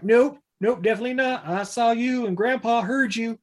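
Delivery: high put-on kid voice; insistent kid.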